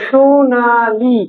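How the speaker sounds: Sonali stretched very slowly, syllable by syllable; drawn-out word. Shown as a demonstration. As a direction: hold each vowel of Sonali long. Teacher sounds out example word. (সোনালি টেনে টেনে ধীরে)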